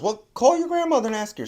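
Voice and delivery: high-pitched retold mother; exasperated mother. Mother sending daughter to grandmother.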